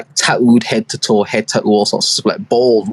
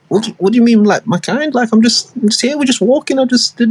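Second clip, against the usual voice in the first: high, innocent playing dumb; mock innocent confusion, voice raised high; 10 semitones higher than usual. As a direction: sound baffled and harmless, pitched up high. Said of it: "he plays dumb".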